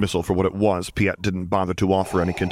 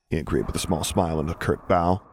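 ordinary delivery

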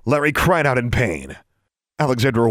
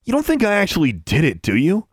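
high, incredulous question; scoffing; 8 semitones higher than usual. A narrator's reading. Bully scoffs at his horrified friend, incredulous. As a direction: scoff with a raised pitch.

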